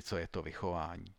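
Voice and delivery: plain reading voice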